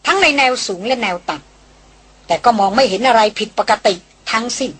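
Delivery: usual speaking voice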